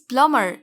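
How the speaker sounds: plumber said PLUM-er, no b; silent B. Shown as a demonstration. Teacher corrects the word, B silent. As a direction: say plumber going from m straight to er. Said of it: "यहाँ बी silent है".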